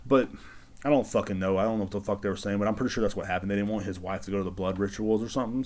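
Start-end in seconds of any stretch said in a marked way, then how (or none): none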